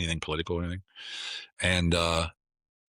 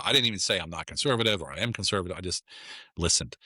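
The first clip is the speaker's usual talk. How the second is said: ordinary talk, nothing marked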